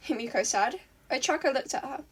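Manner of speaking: ordinary delivery, nothing marked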